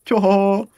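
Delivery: high whooping laugh of relief; relieved yelp of a laugh; surprised whooping laugh. Real, not acted. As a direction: let out a delighted high hoot. Laugh after save.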